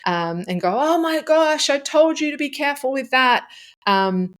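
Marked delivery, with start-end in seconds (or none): 0.8-3.5 high-pitched panicked scolding; shrill it out at the spilled milk as if losing it; freaking out. Shown as a demonstration. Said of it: "do you freak out".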